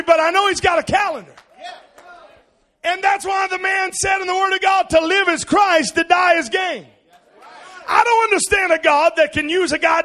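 loud, high, shouted sermon climax; full-force preaching. Acted. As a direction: preach it with everything you have.

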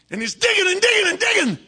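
high, loud, fast, driving repetition; frantic digging. Acted. Preacher acting out a man digging wildly, frantic.

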